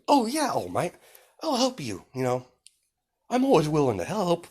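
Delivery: high, sugary eager helper; nice, friendly persona. Acted. Izuku winning All Might's trust, high and nicely friendly. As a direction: offer help in a put-on sweet voice. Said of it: "he's nice and friendly".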